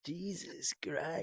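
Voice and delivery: very soft and slow echo; mock exasperated mutter. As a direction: drag it out almost silent. Echoing partner's exasperation.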